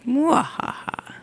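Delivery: slow theatrical villain cackle; a hammed-up witch mwahaha; slow evil laugh. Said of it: "you're evil witch herself".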